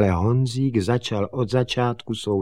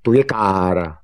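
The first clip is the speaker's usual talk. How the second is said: loud, falling declaration; raised, demonstrative statement; pointing it out; 7 dB louder than usual. Acted. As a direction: announce it firmly to a farmhand. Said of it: "řka".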